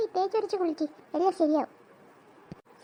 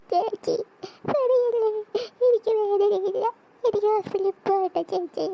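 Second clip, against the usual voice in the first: high, louder whiny pleading; whining; 4 semitones higher, 3 dB louder than usual. Acted. Girl whining to her sister, pleading. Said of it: "മോങ്ങല് തുടങ്ങി".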